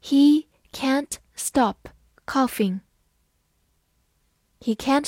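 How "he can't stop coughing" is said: slow, word by word; slow model reading of the sentence. Shown as a demonstration. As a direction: read it slowly for learners. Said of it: "好，慢慢来读".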